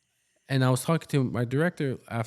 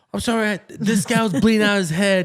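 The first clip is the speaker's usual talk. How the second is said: much higher and louder pretend rant; exasperated outburst; 10 semitones higher, 8 dB louder than usual. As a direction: blurt out what she wishes she could say. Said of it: "you can't, you know, be like".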